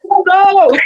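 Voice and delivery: high, laughing mock panic; playful fake alarm; laughing mock dismay. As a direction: squeal it with a laugh. Physical self reacting, laughing.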